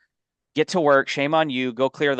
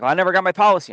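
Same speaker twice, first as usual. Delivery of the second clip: mock upset customer; 6 semitones higher, 4 dB louder, much faster than usual. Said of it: "she was super upset".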